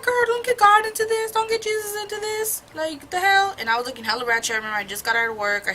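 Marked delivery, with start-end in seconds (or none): 0.0-3.5 high, loud scandalized shriek; outraged squeal. Retold or imitated. Telling her friend not to drag God in, high outraged shriek.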